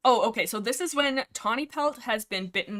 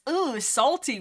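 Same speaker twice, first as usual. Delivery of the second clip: higher, louder "ooh" of distaste; mock disgust; 5 semitones higher, 5 dB louder, slower than usual. Cat grooms salty water off his pelt, mock disgust. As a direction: play up the yucky taste.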